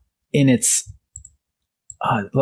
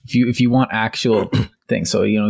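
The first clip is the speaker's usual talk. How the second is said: usual speaking voice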